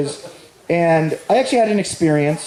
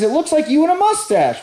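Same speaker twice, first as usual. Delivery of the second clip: high amused voice; 10 semitones higher than usual. Retold or imitated. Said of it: "And he says".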